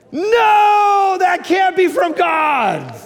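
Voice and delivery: high, loud, slow protest; shouted refusal. Retold or imitated. Pastor arguing with God on his steps, shouting. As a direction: yell no at the idea.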